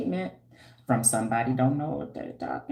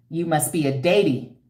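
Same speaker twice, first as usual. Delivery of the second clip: usual speaking voice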